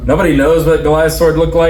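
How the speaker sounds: ordinary talk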